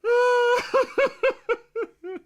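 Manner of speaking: high, bursting laughter; loud laugh. Real, not acted. Laughing at the footage.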